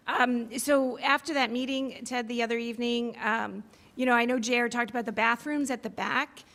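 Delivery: normal talking voice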